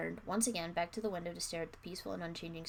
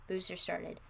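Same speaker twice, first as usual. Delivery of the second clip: usual speaking voice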